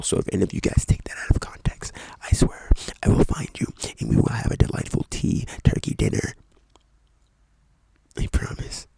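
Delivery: whispered playful threat; mock threat whisper. Whispering a mock threat at viewers who clip it. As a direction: whisper it like a joking menace. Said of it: "purely satire".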